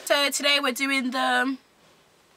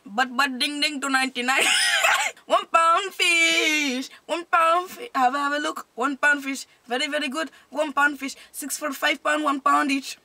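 higher-pitched Indian market seller; Indian accent; 4 semitones higher than usual. Shown as a demonstration.

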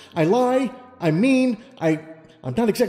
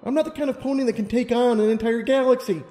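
anxious self-doubt; 11 semitones higher than usual. Acted. Lumina confessing her self-doubt.

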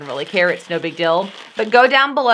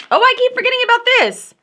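surprised; faster than usual. Real, not acted. Suddenly spots forgotten item.

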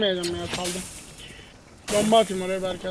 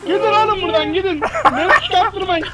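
shouting; 7 semitones higher, 4 dB louder than usual. Real, not acted. Yelling at players to leave.

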